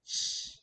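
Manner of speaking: an unvoiced "sh" hiss; sh sound. Shown as a demonstration. Teacher showing students how Q sounds. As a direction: breathe out a long "sh". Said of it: "it sounds like".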